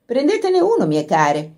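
cosy, soothing coaxing; reassuring cook. A narrator's reading. Cook coaxing the girls, soothing and kind. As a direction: put the girls at ease. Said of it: "con quella voce che metteva a proprio agio".

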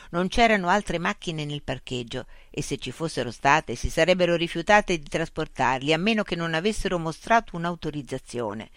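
usual speaking voice

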